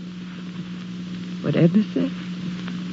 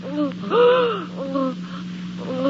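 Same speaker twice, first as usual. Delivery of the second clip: soft, breathy gasp of shock; breath stolen by horror; quiet shocked intake; horrified gasp; 6 semitones higher, 7 dB quieter than usual. Acted. Horror at the sight.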